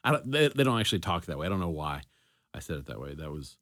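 normal talking voice

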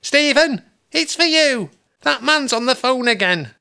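high, loud call up the stairs; mother shouting upstairs. Acted. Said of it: "my mother shouting up the stairs".

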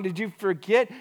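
usual speaking voice

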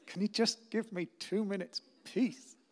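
high, slow, strained plea; acted harried dad; pleading exasperation. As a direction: beg wearily for a moment alone.

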